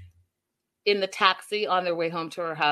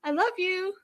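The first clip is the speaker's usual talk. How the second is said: much higher, sing-song lovey I love you; mocking the couple; 11 semitones higher than usual. Host mocks the couple who can only say this, high and sing-song. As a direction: parody the lovestruck pair in a high squeaky voice. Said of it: "these two frickin' frack are like".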